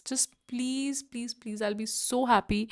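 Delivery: ordinary talk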